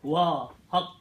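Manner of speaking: measured Urdu verse with dramatic pauses; mushaira-style poetry delivery; ghazal recitation. Acted. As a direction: recite the couplet phrase by phrase, letting each land. Recites Urdu ghazal couplets to audience.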